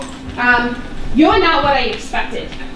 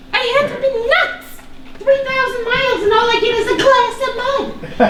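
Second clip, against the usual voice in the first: high, quick words through a bitter laugh; 8 semitones higher than usual. Acted. Daughter mocking her wasted trip to meet him, bitter laughing. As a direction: laugh at her own foolishness.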